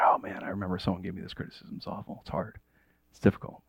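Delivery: low and very soft; subdued recollection. Acted. Listener remembering hurtful criticism, subdued and low. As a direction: let it sink almost under your breath.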